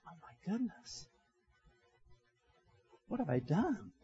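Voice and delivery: very soft, dawning dismay; hushed realization. Retold or imitated. Preacher voicing a woman who suddenly realizes, hushed. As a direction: voice her shocked thought under the breath.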